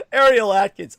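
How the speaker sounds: name squealed high through laughter; cracking up while saying her name; laughing. Real, not acted. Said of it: "see if I can do a right accent".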